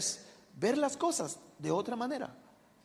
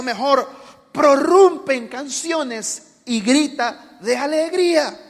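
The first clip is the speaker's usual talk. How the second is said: preaching proclamation; 6 semitones higher, 10 dB louder than usual. Acted. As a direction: declare it as God's call to rejoice. Proclaiming God's words.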